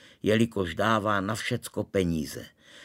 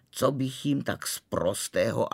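ordinary delivery, nothing marked

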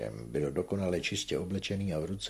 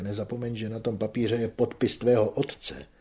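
higher, mildly raised admonishment; reproachful cry; 4 semitones higher, 5 dB louder than usual. A narrator's reading. Mother reminds Manon of father's signature, reproachful cry. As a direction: warn with a touch of outcry. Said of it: "vykřikla matka".

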